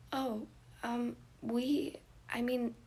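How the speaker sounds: put-on nervous shy boy. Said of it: "finding the courage to speak".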